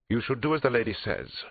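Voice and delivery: high-pitched, haughty put-on voice; haughty English suspect. Suspect backing the therapist against police, haughty. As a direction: superior warning in a put-on voice.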